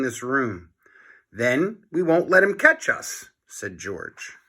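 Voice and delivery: lively storybook read-aloud voice; reading to children. A narrator's reading. Teacher reads chapter aloud to class.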